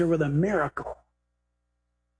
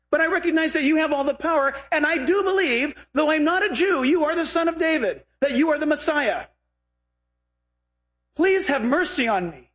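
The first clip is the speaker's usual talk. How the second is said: earnest plea; 7 semitones higher than usual. Retold or imitated. Woman pleading to Jesus.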